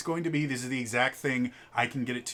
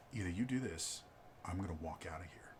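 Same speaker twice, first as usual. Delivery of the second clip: much softer, low ultimatum; quiet threat; 4 semitones lower, 11 dB quieter than usual. Retold or imitated. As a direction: mutter the walk-out threat. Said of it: "he's like".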